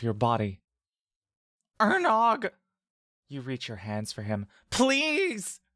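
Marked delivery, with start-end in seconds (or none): 1.8-2.5 high, loud cry of the name; Mock cries out as he is dragged away; desperate cry. Reaching out for brother. 4.7-5.6 high, pleading cry; Mock begs the brother while being pulled off; desperate plea. Begging the brother.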